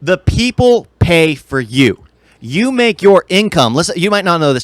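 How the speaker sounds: usual speaking voice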